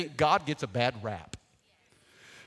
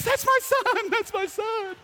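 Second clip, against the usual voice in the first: much higher, proud squeal of a mom; 14 semitones higher, 4 dB louder than usual. Retold or imitated. Mom seeing the newspaper photo, high proud squeal. Mama giving copies to friends.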